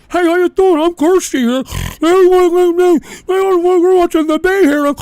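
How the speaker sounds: high, loud mocking girly voice; mock VTuber voice. Mocking a rival VTuber's greeting, high and sneering. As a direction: sneer it as a fake cutesy streamer. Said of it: "You can hear the fat in her windpipe".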